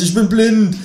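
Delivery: high, loud wail of ich bin blind; mock cry. Narrator blinded by the torch, high wail. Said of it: "schrei ich".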